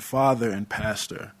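ordinary talk, nothing marked

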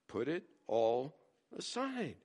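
tossed off matter-of-factly; dry answer to the whiner; deadpan reply. Pretend.

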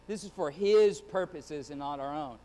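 "His" leaned on, falling firmly; stress on His purposes. Real, not acted. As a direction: press "His" and "not our own". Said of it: "let me place emphasis on His purposes".

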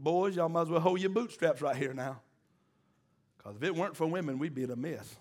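usual speaking voice

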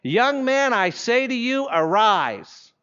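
high, ringing order to rise; loud command. Acted. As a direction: call out a solemn command. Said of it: "says in a loud voice".

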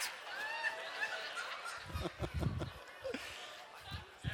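high breathless laugh; airy laugh. Real, not acted. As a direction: burst out laughing at your own joke. Laughs at own joke.

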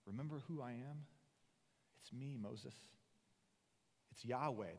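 soft, intimate reassurance; tender. Acted. God to angry Moses held close, tenderly. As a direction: speak softly, as if holding an upset child close.

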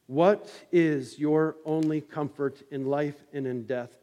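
ordinary talk, nothing marked

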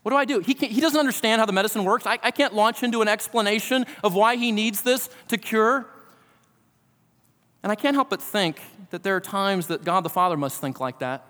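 ordinary delivery

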